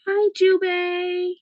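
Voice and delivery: high, drawn-out pet-talk greeting; gushing pet greeting. Real, not acted. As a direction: coo it slowly to a pet.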